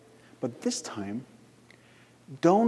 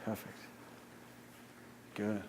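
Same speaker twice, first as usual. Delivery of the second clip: hypnotic guidance; 3 semitones lower than usual. Acted. Guides trance acceleration.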